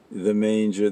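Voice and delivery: ordinary talk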